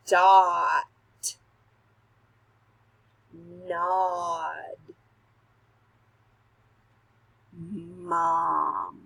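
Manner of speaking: each word said clearly, middle "ah" stressed; modelled words. Shown as a demonstration. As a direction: model each word slowly for the kids to echo. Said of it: "Repeat the words after me".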